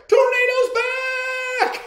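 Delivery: high, drawn-out shout; joyful yell. Acted. Child yelling that the lost dog is back, elated shout. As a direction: yell it out at full voice, stretching the words. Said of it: "I yelled".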